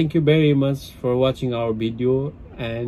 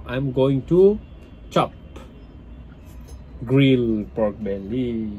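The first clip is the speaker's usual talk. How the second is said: ordinary talk, nothing marked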